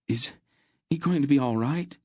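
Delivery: put-on boy pleading over his wounded friend, afraid; anxious appeal. A narrator's reading. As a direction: beg for reassurance. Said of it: "he appealed to Aunt Paul".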